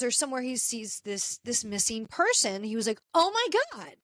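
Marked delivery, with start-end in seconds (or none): 3.1-3.9 high-pitched retold "oh my God" of realization; shocked recognition. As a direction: voice leaps up, acting out the witness's shock. Witness recognizes missing woman in photo.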